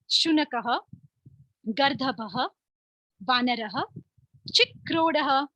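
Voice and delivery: carefully recited Sanskrit names; slow recitation. Shown as a demonstration. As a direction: say each name slowly and clearly for pupils. Teacher drills animal names in Sanskrit. (धीमे, स्पष्ट उच्चारण में पाठ)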